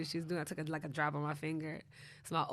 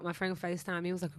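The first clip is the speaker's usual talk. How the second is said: ordinary talk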